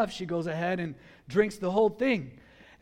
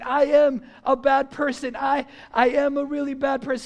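higher, louder guilty realization; acted panic; 4 semitones higher, 4 dB louder than usual. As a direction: reenact dawning horror in halting bursts. Acting out his panicked guilty realization.

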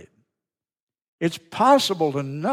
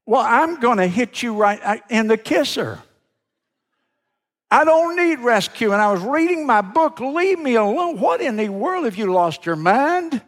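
higher pitched mock indignation, peaking on don't need rescue; indignant outburst; 7 semitones higher, 3 dB louder than usual. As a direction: bristle at being dragged off the pier. Preacher annoyed at unwanted rescuer.

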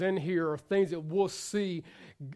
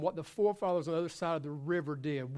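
normal talking voice

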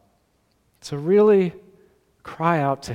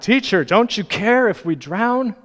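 higher, louder retold plea; raised-voice imitation of desperate disciples; crying out; 7 semitones higher, 7 dB louder than usual. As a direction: cry out in alarm to a teacher. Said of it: "they cry out".

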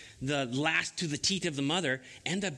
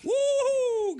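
very high, loud cheering whoop; excited cheer; 7 dB louder than usual. Real, not acted. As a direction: celebrate like a big win. Dog finally does trick.

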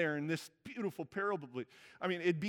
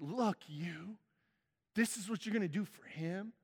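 a lightly voiced, pointed "Look, you"; accusing. Older son confronting his father, accusing. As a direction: jab each word at him. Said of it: "That's the finger pointed in the face".

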